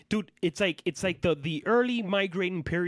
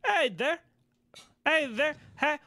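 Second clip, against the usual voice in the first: high-pitched mock Canadian greeting; attempted Canadian accent; 10 semitones higher than usual. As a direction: put on a hammy Canadian accent. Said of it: "I can't do a Canadian accent".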